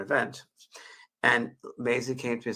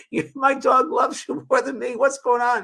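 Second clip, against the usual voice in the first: much higher, louder wailing voice of a friend; imitated crying outburst; 14 semitones higher, 8 dB louder than usual. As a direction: mimic the friend's tearful outcry. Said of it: "he started crying".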